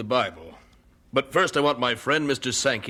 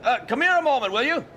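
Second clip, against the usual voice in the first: calling out across the hall; 13 semitones higher than usual. Acted. Moody calls the organist over.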